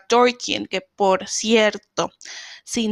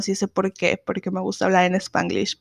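usual speaking voice

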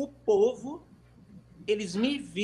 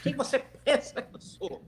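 arrogant retort; 4 semitones higher than usual. Retold or imitated. Someone puffed up at the stage producer, mock-indignant. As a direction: act puffed-up pride, half-laughing. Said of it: "levantou aquela pessoa".

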